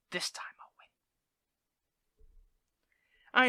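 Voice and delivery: whispered menacing vow; dramatic whisper. Acted. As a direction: hush it like a secret threat.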